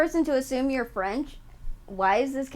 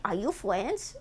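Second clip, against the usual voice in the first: silly mock French accent with softened R; 3 semitones lower, 7 dB quieter than usual. Streamer imitating random callers, mock French accent. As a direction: ask it in a babyish fake French accent. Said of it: "People call you randomly and say".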